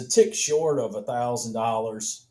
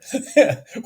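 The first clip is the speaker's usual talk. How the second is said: high burst of laughter; 12 semitones higher, 6 dB louder than usual. Real, not acted. Amused at own face.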